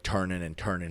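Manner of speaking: normal talking voice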